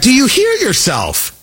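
high, drawn-out incredulous question; J D Vance. Retold or imitated. Host repeating candidate impression, voice going up. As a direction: imitate a disbelieving challenge, voice way up. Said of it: "that was not a bad impression".

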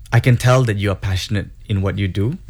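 usual speaking voice

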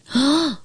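high, sudden intake of breath; sharp gasp. A narrator's reading. Children startled at the water's edge, sudden gasp. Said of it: "We all gasped".

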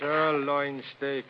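slow, low, longing echo; savouring. Acted. Café owner longing for steak he lacks, savouring. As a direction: linger on each syllable, dropped low.

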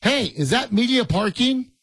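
mock yell to a parking attendant from the car; retold yell. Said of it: "And so I yell to the guy".

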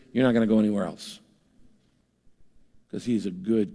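usual speaking voice

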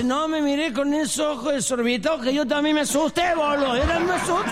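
acted fright. Man after the gun goes off.

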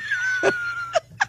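long, high laughing fit; helpless laughter. Real, not acted. Co-host recalling her walkout, laughing helplessly. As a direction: crack up completely, very high.

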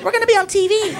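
put-on high girly squeal of excitement; freaking out. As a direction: squeal it high, imitating thrilled passengers. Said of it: "they're freaking out".

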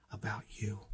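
soft whisper, barely voiced. Real, not acted. Preacher hushing as God's love for the congregation. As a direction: let it fall to a hush. Tender close of point.

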